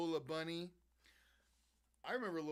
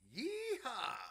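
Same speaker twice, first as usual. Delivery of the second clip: yeehaw yell; 8 dB louder than usual. Real, not acted. Cheering a card pull.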